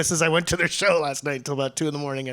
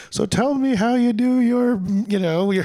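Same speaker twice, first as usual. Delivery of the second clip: quoted interviewer; 7 semitones higher, 3 dB louder than usual. Retold or imitated. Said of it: "but somebody was like".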